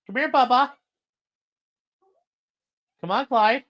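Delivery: high coaxing call to a pet; high pet-talk coaxing the dog to come. Real, not acted. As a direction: coax the dog over, high and sweet.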